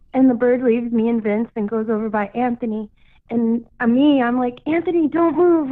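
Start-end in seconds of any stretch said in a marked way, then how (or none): 4.7-5.7 higher urgent call across the beach; retold urgent call to a son. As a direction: warn the boy to freeze. Calling to son near the bird.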